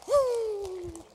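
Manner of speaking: loud high joyful whoop; a real spontaneous woo of excitement; ecstatic whoop. Real, not acted. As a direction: let out a thrilled shout of praise. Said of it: "I just got goosebumps".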